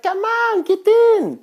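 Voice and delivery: high, bright imitation of pushy friends; chirping up high like the friends; mock Filipino friends. Friends urging him into the packed car, high imitation.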